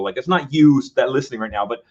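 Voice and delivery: usual speaking voice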